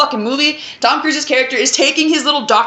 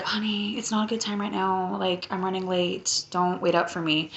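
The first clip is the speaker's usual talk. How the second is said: much softer, hushed excuse to the wife; hushed phone excuse; 3 semitones lower, 11 dB quieter than usual. Retold or imitated. Doctor hushes a phone excuse to his wife, much softer. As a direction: drop way down, hushed, keeping the call secret.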